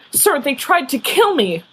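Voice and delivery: bursting out. Acted. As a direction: explode at the headmaster. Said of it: "bursts out".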